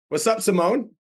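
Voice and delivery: bright, high call-out greeting; upbeat greeting. Real, not acted. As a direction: greet her with big energy. Greeting the next caller.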